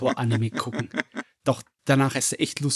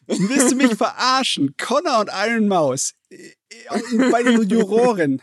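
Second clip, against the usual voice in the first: high, loud, slow disbelief; you can't be serious; incredulous; 9 semitones higher, 5 dB louder, slower than usual. Real, not acted. Disbelief at the jury line-up.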